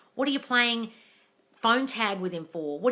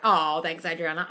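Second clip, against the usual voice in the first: ordinary delivery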